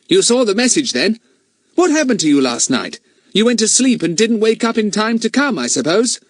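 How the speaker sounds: lightly voiced confident boy; Fatty voice. Fatty quizzes Ern about last night, brisk and high.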